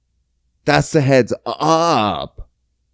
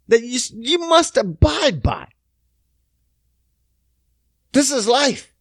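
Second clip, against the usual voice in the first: ordinary talk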